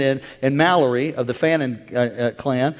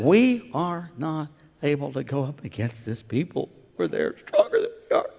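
timid scouts; 2 semitones lower, 8 dB quieter than usual. Acted. Fellow scouts telling Caleb they can't, faint. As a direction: shrink back in fear.

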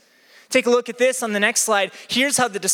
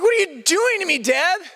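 mock plea; 9 semitones higher than usual. Pleading with shouting coach.

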